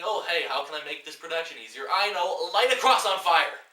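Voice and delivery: loud, rising, hammed-up eureka voice; loud mock brainstorm; mock bright idea. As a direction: play a dim filmmaker thrilled by his idea. Mocking a bored filmmaker.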